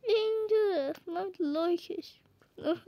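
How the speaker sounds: usual speaking voice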